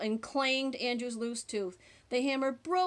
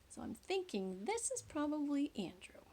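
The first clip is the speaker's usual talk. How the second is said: whisper; 2 semitones higher, 8 dB quieter than usual. Real, not acted. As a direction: lean in and whisper it. Aside to listeners.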